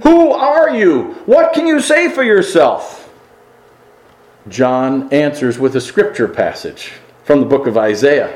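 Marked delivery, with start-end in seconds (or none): 0.0-3.1 high, slow, pointed question; frank challenge. Retold or imitated. Religious leaders confronting John, high and pressing. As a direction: demand an answer from the prophet. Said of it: "they ask him very frankly".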